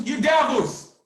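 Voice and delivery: high, loud accusing shout; retold angry shout. Host retelling how he shouted at the agents, angry shout. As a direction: yell it straight at the agents.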